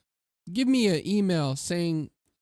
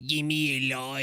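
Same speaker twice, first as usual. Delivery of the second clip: mock Aussie bogan accent; put-on Australian accent; 3 semitones lower than usual. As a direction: imitate a rough Australian bogan.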